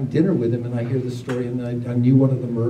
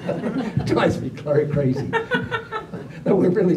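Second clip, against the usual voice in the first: laughing through the words; laughing about a friend who drives him crazy; 7 semitones higher than usual. Real, not acted. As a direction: break up with laughter mid-word.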